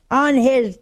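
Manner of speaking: slow, loud mock indignation; getting hot. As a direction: imitate a widow working up to anger. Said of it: "boy, she's getting hot".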